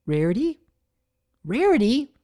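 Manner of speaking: urgent call of "Rarity"; calling after. A narrator's reading. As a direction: call after her as she leaves. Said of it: "called after her".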